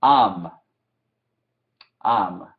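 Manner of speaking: teacher models "Am" for the class; repeat after me. Shown as a demonstration. Teacher to students, modelling a word to repeat. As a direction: say "Am" clearly, for students to echo. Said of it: "digan después de mí".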